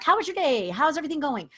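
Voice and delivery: mock-cheery mom tossing questions over her shoulder; chirpy busy mom. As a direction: mom at the dishwasher greeting her kids, high and bright. Busy mom greeting kids distractedly.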